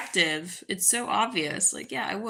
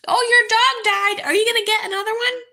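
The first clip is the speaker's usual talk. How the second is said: much higher, louder, fast mock voice of nosy askers; shrill mockery; 12 semitones higher, 5 dB louder, faster than usual. Mocking anonymous people asking about another dog, high and fast. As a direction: squeal the question like a silly caricature. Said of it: "so weird about this question".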